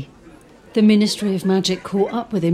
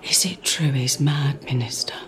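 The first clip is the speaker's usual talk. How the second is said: whisper; 6 semitones lower, 4 dB quieter than usual. Acted. Rosmerta asking the minister, whispered. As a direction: lower and breathy, a secret question.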